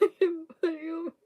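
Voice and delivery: high laughing squeal. Real, not acted. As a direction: talk through a big laugh. Laughing hard mid-sentence.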